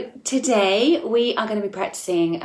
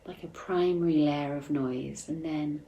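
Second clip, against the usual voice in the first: soft, unhurried meditation voice with long pauses; calm meditation guide, gradually growing softer; guided meditation; 7 dB quieter than usual. Acted. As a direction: guide listeners inward gently, leaving space between phrases.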